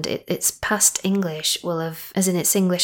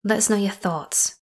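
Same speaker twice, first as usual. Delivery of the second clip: normal talking voice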